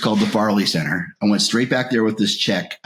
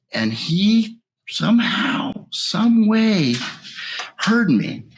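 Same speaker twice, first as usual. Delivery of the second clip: choked up; 11 semitones higher, much slower than usual. Real, not acted. Emotional memory of being heard.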